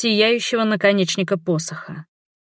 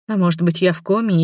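plain reading voice

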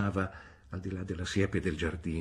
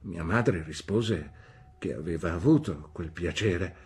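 ordinary delivery

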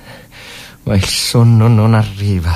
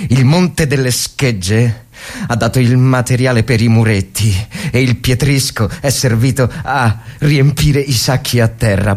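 ordinary delivery

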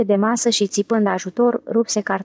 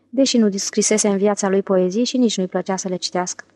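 plain reading voice

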